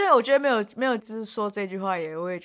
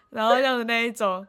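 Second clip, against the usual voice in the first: ordinary delivery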